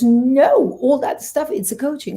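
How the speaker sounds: imitating a client's high-pitched correction; insistent correction. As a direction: start on a loud, high No and insist. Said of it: "He goes".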